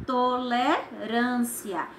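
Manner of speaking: slow, drawn-out "Tolerância", syllables separated. Shown as a demonstration. Teacher showing pupils how the word will end up, syllable by syllable. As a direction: stretch out each syllable of "Tolerância".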